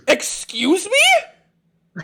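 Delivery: shrieked, very high and drawn out; a mother's horrified disbelief; panicked mother. Acted. Mother panicking at her daughter volunteering, a shrieked high question. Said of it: "in sheer panic".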